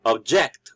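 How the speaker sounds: ob-JECT, punch the second syllable; second-syllable stress. Shown as a demonstration. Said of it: "we are stressing the second syllable".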